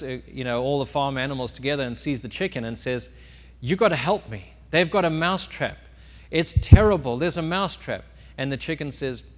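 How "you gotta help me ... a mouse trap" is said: higher, louder urgent plea; alarmed mouse. Acted. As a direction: plead for help in mock panic. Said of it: "the mouse was devastated".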